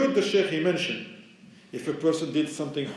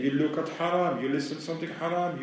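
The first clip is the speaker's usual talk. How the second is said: normal talking voice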